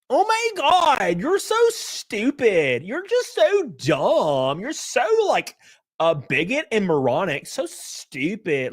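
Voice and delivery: high, slow mocking Valley Girl accent; Hasan Piker Valley Girl impression. Mimicking a debater backed into a corner, Valley Girl accent. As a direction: stretch every insult like an exaggerated Valley Girl. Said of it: "he'll do the same thing".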